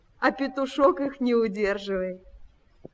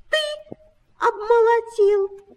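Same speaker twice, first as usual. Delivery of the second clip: high squeaky reply, less forceful; quieter mice; 11 semitones higher, slower than usual. A narrator's reading. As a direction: pipe it up high, holding back. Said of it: "потише сказали оба".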